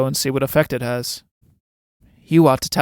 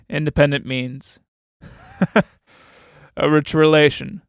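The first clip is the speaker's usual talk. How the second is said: laughing. Acted. Mocking the newcomer's fortune.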